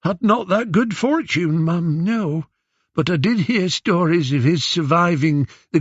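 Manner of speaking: put-on high, whining old man with eastern Aegean accent; old man's accented whine. Disguised Odysseus answering Queen Penelope, old man's accented whine. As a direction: disguise yourself as a foreign old beggar. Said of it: "old man's hoarse whine".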